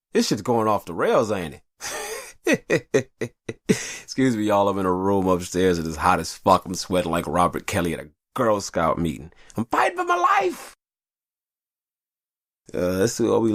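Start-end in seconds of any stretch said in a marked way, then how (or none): 1.8-3.6 high laugh. Real, not acted. Laughs at himself. 9.6-10.8 high, loud hammed-up wail; mock anguished cry. Sweating in a hot room upstairs, hammed-up high wail.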